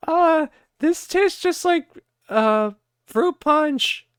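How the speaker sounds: high, slow, dismissive sister voice; mocking sister. Vlogger mimicking sister dismissing the flavor, high slow mock. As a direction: drawl it out high and careless. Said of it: "And I said".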